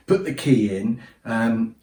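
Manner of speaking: normal talking voice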